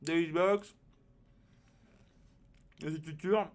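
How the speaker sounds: usual speaking voice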